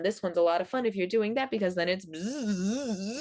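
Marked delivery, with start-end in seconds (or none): 2.1-3.2 wavering bee buzz climbing at the end. Shown as a demonstration. Teacher shows buzzing while going up and down, wavy buzz. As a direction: buzz a long wavy line. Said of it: "going up and down".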